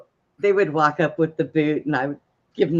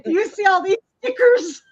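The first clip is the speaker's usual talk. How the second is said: very high, louder, half-crying mock protest; mock exasperated plea; 5 dB louder than usual. To the fireman who refuses her card: high, mock-desperate whine. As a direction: whine it like you've been robbed all day.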